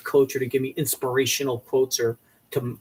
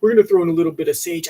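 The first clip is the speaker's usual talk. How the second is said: mock TV host voice; 3 semitones higher, 6 dB louder, faster than usual. Mocking fancy TV talk.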